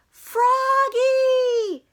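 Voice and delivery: high, loud calling out a name; yell. Acted. Frogalina yelling after Froggy, high and loud. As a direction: shout his name across the field. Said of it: "yelled Frogalina".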